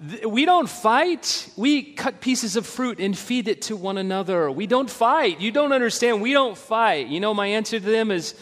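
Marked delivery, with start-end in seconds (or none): 0.1-7.1 high, loud mock protest; hammed-up couple insisting; insistent denial. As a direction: overdo the denial comically. Imitating couple insisting no fights.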